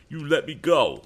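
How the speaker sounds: high, loud demand from the squirming bully; demanding to be let go. A narrator's reading. Sam struggling in Ned's grip, loud and demanding. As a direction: yell it while twisting free. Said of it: "demanded Sam as he tried to twist loose".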